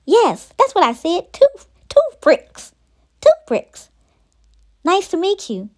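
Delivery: high-pitched snake voice; high, insistent little snake character; hissing little snake. A narrator's reading. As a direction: pipe it up high like a small snake. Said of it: "she hissed".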